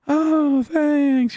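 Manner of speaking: high, drawn-out fake thanks; feigned gratitude. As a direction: thank them politely in a put-on high voice. Feigned thanks for unwanted gift.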